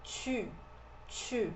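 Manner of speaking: tu with lips puckered, like "tchü"; tu with a French front rounded vowel. Shown as a demonstration. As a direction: pout the lips on the U of tu. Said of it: "a gente faz o biquinho".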